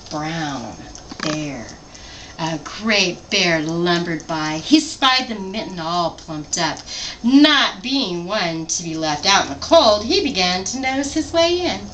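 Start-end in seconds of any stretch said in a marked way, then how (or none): none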